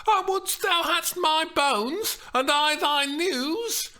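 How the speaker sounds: high, loud, impatient; Juliet pleading. Acted. Juliet impatient with the nurse, high and loud. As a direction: trade bones for news.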